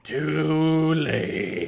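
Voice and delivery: hammed-up, elongated rasp. A narrator's reading. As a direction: stretch it into a villain's taunt. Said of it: "Sal rasped".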